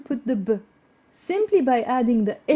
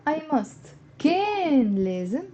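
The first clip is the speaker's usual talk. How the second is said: usual speaking voice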